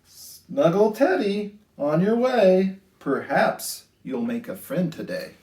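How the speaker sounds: sing-song rhyming storybook read; lilting picture-book voice; reading to children. A narrator's reading. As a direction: read it gently to a class of little kids.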